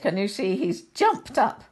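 ordinary delivery

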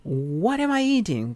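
slow and high, word by word; a slow teacher's example read; slow model question. Shown as a demonstration. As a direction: model it slowly for the class. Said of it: "W H question".

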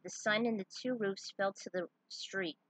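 ordinary delivery

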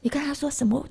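ordinary delivery

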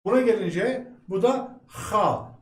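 usual speaking voice

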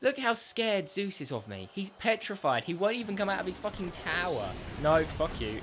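usual speaking voice

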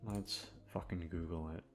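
ordinary delivery

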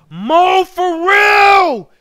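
long, high holler; huge drawn-out hail; excited shout-out. Real, not acted. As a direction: yell a greeting across the street, stretching it out. Hails a viewer by name.

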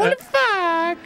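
high cartoon squeal; high squeaky voice. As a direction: keep the squeaky pitch. Continuing squeaky outburst.

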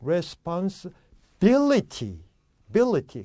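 slow demo of responsibility stressed on BIL; accent on BIL. Shown as a demonstration. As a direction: break before bility and hit BIL hard. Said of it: "액센트 어디 있죠?".

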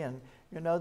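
ordinary talk, nothing marked

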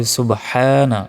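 "সুবহানা" with a strong throaty h, long vowel; big ha. Shown as a demonstration. Teacher correcting the letter ha, drawn-out pronunciation. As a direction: stretch the "হা" in "সুবহানা". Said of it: "এখানে বড় হা রয়েছে".

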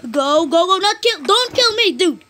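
high frantic yell; panicked gamer. Real, not acted. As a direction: shout in panic mid-fight. Panicked combat.

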